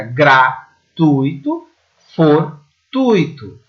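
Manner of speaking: gratuito, fortuito broken slowly at the syllables, tui held as one; slow split: gra-TUI-to, for-TUI-to. Shown as a demonstration. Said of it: "Separando as sílabas". (gratuito, fortuito devagar, separando as sílabas)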